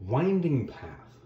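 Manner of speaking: very slow, stretched winding path; winding path said at a crawl; drawn-out phrase. Shown as a demonstration. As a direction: let the words wind slowly. Stretches phrase to show meandering.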